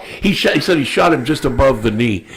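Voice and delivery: normal talking voice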